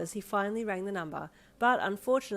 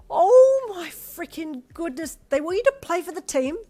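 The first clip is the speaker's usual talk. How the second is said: much higher and louder, slow gush; thrilled; 11 semitones higher, 13 dB louder than usual. A narrator's reading. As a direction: gush with delight. Girlfriend thrilled at news.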